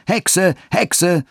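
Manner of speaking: high-pitched mob jeer, pitch thrown right up; shrieked taunt. A narrator's reading. Village boys jeering at old Gertrud, shrieking taunt. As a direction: cry it out like boys hounding an old woman. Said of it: "und schrien".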